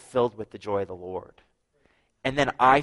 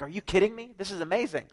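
amazed; 8 semitones higher than usual. Retold or imitated. Teen amazed by God.

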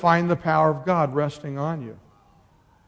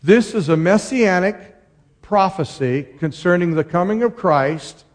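ordinary talk, nothing marked

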